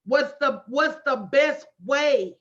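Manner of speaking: ordinary talk, nothing marked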